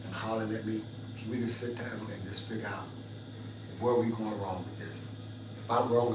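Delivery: soft, low, tender apology; soft tender plea. Shown as a demonstration. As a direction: murmur it softly, calming him down. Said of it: "kiss him on his cheek and say".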